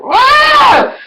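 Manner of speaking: high, loud, hurt exclamation; stunned wow. Retold or imitated. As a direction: shout it like you've been slapped. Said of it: "I was like".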